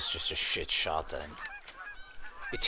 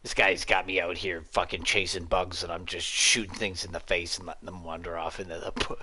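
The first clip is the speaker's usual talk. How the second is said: usual speaking voice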